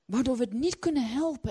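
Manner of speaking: ordinary talk, nothing marked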